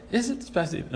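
soft, understated question; mild question. Shown as a demonstration. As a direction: ask it softly, as if merely checking. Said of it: "I don't read it like".

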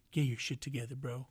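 ordinary talk

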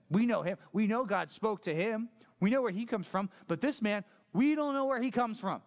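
high, emphatic self-righteous insistence; pompous, indignant Pharisee; haughty. Acted. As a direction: pitch it up and dismiss the outsider. Acted haughty Pharisees' reply.

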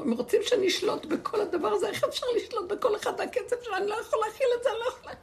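high, loud complaint half laughed through; laughing mock yell. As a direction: imitate the manager ranting, cracking up. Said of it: "המנהל התחיל לצעוק". (חיקוי צעקה גבוהה עם צחוק)

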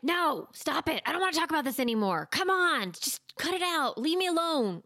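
put-on high, whiny kid pushing back; resisting child. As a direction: act the fed-up child telling mom to stop. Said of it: "And they're like".

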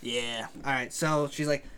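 ordinary talk

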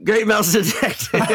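high, laughing repeat of the answer; laughing confirmation. Real, not acted. Quiz host confirms contestant's answer, laughing. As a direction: confirm it while breaking into real laughter.

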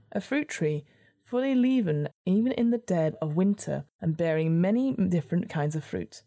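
ordinary talk, nothing marked

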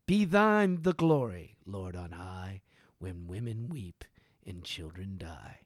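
loud opening, fading low and hushed; solemn prayer close. Acted. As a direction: swell on the praise, then sink to a grave hush.

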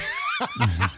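real laugh, loud and high; loud, high-pitched cracking up; high burst of laughter. Real, not acted. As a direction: burst out laughing mid-story. Laughs while telling dog story.

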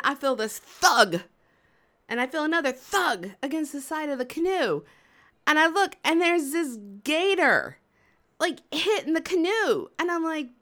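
ordinary delivery

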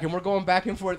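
ordinary talk, nothing marked

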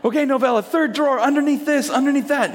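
high-pitched list, item by item; frazzled instructions. Retold or imitated. Dad sending daughter to the drawer, acted frazzled urgency. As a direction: relay directions urgently to a child.

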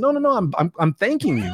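high-pitched, hurried protest; eager reassurance. Retold or imitated. Man reassures apologizing stranger in line, eagerly and high. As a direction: rush to wave away the apology, pitch up.

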